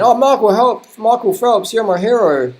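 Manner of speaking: high, gushing fanboy voice; mock adoring fan. Mocking fans who fawn over Phelps, high and gushing.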